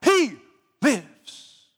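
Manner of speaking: put-on loud, high British-accented chant of I die, he lives; booming British-accent preacher cry; shouted British-accented imitation. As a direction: belt it like an old British preacher. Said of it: "With a British accent, he would say".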